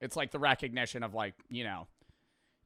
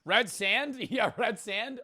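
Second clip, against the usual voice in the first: higher, slowed, laughing surprise; amused surprise; 9 semitones higher, 5 dB louder, slower than usual. Real, not acted. Streamer spotting red sand on the map, surprised laughter. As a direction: catch the clue and laugh it out.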